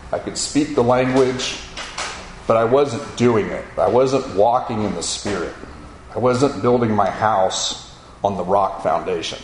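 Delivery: normal talking voice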